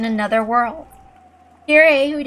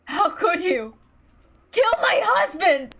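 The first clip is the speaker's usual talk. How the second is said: anguished accusation; 8 semitones higher, slower than usual. Acted. Wife confronts husband's killer.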